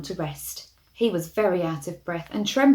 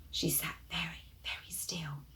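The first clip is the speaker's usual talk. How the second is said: hushed whisper; 3 semitones lower, 14 dB quieter than usual. A narrator's reading. Cat stalking, hushed reading.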